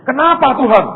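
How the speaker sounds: loud high outcry at God; protesting cry. Retold or imitated. Asking God why, retold. (seruan protes keras dan tinggi)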